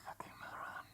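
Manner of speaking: breathy whisper, muttered to himself; whispered self-insult. Real, not acted. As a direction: hiss fucking moron at yourself under your breath.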